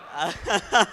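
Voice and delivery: a quick laugh after the game; brief warm laugh; short laugh. Real, not acted. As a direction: laugh with the room.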